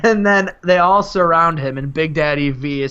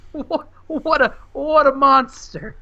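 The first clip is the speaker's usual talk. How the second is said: high, slow, aghast exclamation; mock outrage; 9 semitones higher, slower than usual. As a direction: draw it out in hammed-up horror. Mock commentary on the taco attack.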